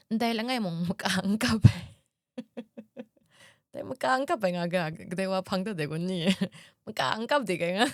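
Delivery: real laughter breaking into the words; laughing. Real, not acted. Speaker retelling a funny remark, laughing.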